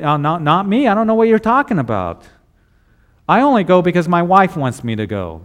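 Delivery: evasive denial. Acted. Believer dodging the boys at the job, hammed-up defensive denial. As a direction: act out hastily disowning it all. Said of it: "do you say".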